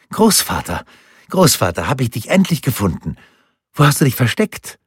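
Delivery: an excited grandson bursting in; eager little boy. Acted. Grandson runs in calling for his grandfather, high excited child voice.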